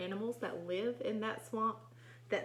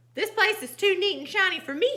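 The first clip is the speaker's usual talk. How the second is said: high, loud, quick complaint; storybook indignation; angry small pig; 10 semitones higher, 11 dB louder than usual. A narrator's reading. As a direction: protest crossly as a little pig. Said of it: "He is angry".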